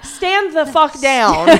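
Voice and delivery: high, slow, laughing stand-down; drawn-out laughing threat; laughing mock order. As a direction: snarl the order while cracking up. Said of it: "Coulson's like".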